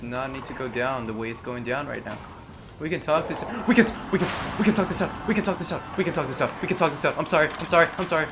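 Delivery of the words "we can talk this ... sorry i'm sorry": panicked pleading. Real, not acted. Pleading with an attacker.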